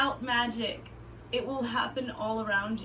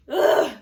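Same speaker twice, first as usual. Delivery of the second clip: loud, gagging play groan; disgusted groan; 10 dB louder than usual. Pretend. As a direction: groan at an imagined stink. Reacting to pretend skunk spray.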